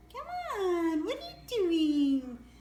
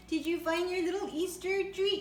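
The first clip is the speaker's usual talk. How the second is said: usual speaking voice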